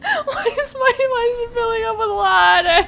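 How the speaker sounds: high, loud alarm; real panic at sudden disaster; panicked yelling. Real, not acted. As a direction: shout in shocked disbelief. Base suddenly filling with water.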